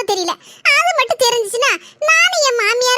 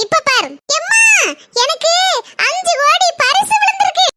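calling out bait; 7 semitones higher than usual. Pretend. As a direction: shout it out so she comes running. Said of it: "அவளை எப்படி ஓடி வர வைக்கணும்னு எனக்கு தெரியும்".

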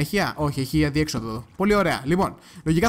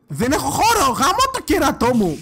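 loud, high-pitched exasperated shout; frustrated outburst; 9 semitones higher, 5 dB louder, slower than usual. Real, not acted. Player out of inventory space, exasperated shout. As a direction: explode in frustration, stretching out the curse.

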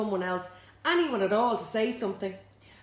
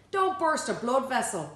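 mocking; 8 semitones higher, 5 dB louder than usual. Walker mocking narrator.

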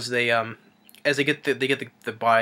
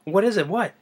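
confused question; 9 semitones higher, 4 dB louder than usual. Retold or imitated. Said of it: "He's like".

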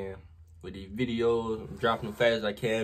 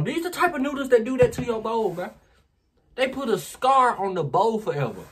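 excited; 11 semitones higher, 8 dB louder than usual. Real, not acted. Hyped about red noodles.

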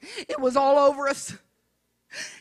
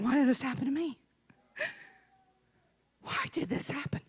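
very soft question fading to a whisper; hushed bewildered plea; 10 dB quieter, faster than usual. Acted. Hurt believer wonders why this happened, hushed fading plea. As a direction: ask it small, sinking into a whisper.

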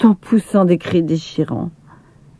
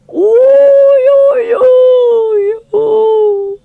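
high, loud wailing sobs; terrified girl sobbing; 6 dB louder, much slower than usual. A narrator's reading. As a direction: sob each ouïe in fear. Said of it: "sanglota Lina".

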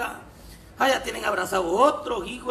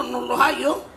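normal talking voice

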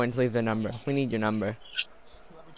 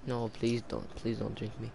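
much softer begging vodka; quiet pleading; 4 semitones lower, 11 dB quieter than usual. Pretend. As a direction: beg it under your breath. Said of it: "Oh, Comrade".

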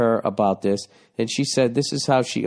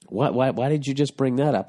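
normal talking voice

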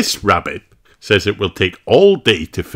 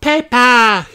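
high, loud delighted cry; high joyful cry; excited call; 5 dB louder than usual. Acted. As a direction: shout your friend's name happily. Susie greets.

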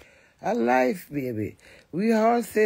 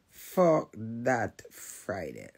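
Fuck that Friday dragged out slowly and lower; slow low repeat; 8 semitones lower, 6 dB quieter, much slower than usual. Real, not acted.

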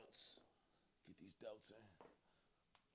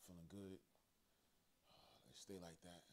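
out of breath; 8 dB quieter than usual. Real, not acted. Sighing through a heavy set.

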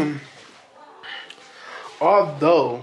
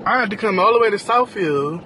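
exasperated rant; 9 semitones higher than usual. Real, not acted. Ranting about the city.

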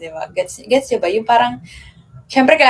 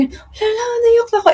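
high squeaky gasp, half laughing, half crying; surprised laughing cry; 13 semitones higher than usual. Retold or imitated. Retelling crying on the train, a high surprised squeal. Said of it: "pero nakakatawa kasi".

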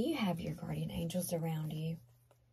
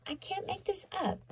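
high-pitched disbelief; 13 semitones higher, 4 dB quieter than usual. Real, not acted. Repeats in disbelief.

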